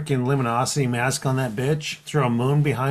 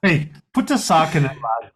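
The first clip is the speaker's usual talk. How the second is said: mock Irish accent. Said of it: "You're from Ireland".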